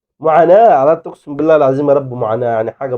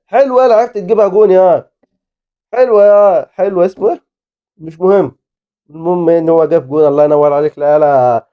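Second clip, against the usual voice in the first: ordinary delivery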